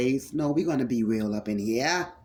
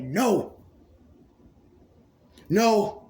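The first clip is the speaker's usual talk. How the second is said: second No higher and louder; firm refusal; 6 semitones higher, 3 dB louder than usual. Pretend. Voicing listener rejecting the rot, firm loud no. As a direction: refuse it outright. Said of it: "but you said".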